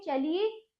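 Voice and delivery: ordinary talk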